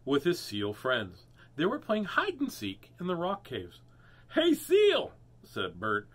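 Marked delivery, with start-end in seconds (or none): 4.3-5.1 very high, loud call; cheerful hail. Acted. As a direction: yell hello to the seal. Turtle hailing seal.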